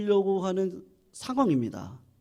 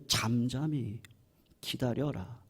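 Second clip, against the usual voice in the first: quiet; 10 semitones lower, 11 dB quieter, slower than usual. Shown as a demonstration. Quoting David's call to stillness.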